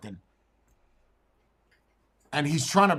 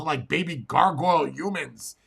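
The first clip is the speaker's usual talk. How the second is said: usual speaking voice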